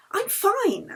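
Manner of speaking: high-pitched Anna brushing it off; insisting. Acted. Anna insists to Yeli she is fine, high and firm. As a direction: wave the worry away, high. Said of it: "Anna insisted".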